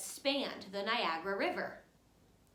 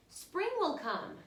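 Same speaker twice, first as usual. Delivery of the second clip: kites swishing; 8 semitones higher than usual. A narrator's reading. Kites calling over the night sky, high and hopeful. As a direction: let the kite call out its hope, lifted high. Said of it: "they swish".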